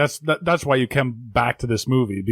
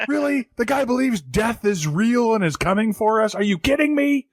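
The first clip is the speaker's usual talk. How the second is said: ranting anchor; 8 semitones higher than usual. Retold or imitated.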